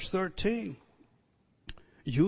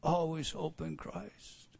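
soft echo; 2 semitones lower, 7 dB quieter, slower than usual. Real, not acted. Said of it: "the exciting part".

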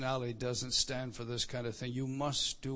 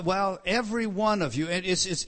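ordinary talk, nothing marked

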